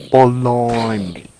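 Blimey said in a mock British accent. Shown as a demonstration. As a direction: say Blimey like a cartoon Brit. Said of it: "Or should I just say".

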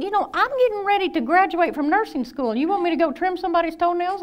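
high, indignant protest; can't believe she's asked this; indignant disbelief. Retold or imitated. Student protesting to her supervisors, high and indignant.

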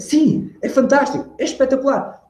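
high-pitched, lively praise; animated demo of delight; enthusiastic. Shown as a demonstration. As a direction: exclaim it as if thrilled, pitch soaring. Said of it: "E se eu fizesse".

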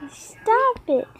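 high, softened whine. Pretend. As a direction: repeat it small and high, pleading. Repeats protest more softly.

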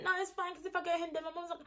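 high, softened voice mimicking the friend; imitating friend's excuse. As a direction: brush it off lightly in her high voice. Imitated friend making excuses to stay.

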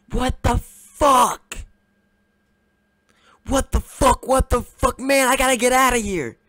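loud, high-pitched panicked shouts; panicked screaming. Acted. Boyfriend has gotta get outta here, panicked screaming. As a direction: scream in shock and bolt.

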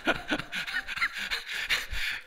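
laughs it off, quick and bright; real laugh. Real, not acted. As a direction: breaks into a real laugh mid-thought. 讲到当爷爷的趣事. (忍不住笑出声)

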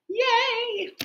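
sudden high squeal of joy; excited cheer. Real, not acted. Teacher celebrating the museum sale for the kids, high excited cheer. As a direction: cheer like the class just won.

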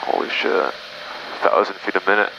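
ordinary talk

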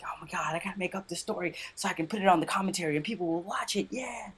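high, hushed mock excitement; hammed-up high-pitched scheming; mock story-faker. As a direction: put on a giddy schemer's voice, high and soft. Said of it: "make up stories".

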